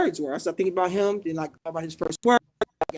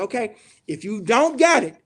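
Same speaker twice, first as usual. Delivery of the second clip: mock ultimatum; 3 semitones higher, 3 dB louder than usual. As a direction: threaten like a boss laying down terms. Acting out the boss's warning.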